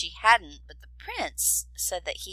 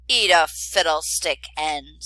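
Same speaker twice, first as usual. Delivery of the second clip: very rudely; 2 semitones lower, 9 dB louder than usual. A narrator's reading. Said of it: "said the dragon very rudely".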